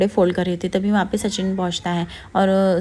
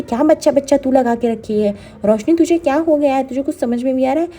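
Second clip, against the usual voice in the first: angry scolding; 5 semitones higher, 4 dB louder than usual. Retold or imitated. Aunt scolding Roshni angrily.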